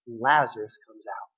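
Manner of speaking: normal talking voice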